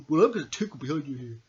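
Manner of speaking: normal talking voice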